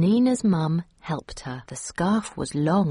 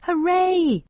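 high, loud cheer; shouted cheer; 12 semitones higher, 5 dB louder than usual. A narrator's reading. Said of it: "shouted Anina".

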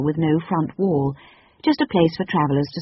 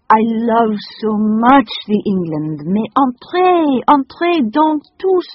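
put-on high, loud gushing French-accented lady; 6 semitones higher, 7 dB louder, slower than usual. A narrator's reading. The French lady welcomes the children, high gushing French accent.

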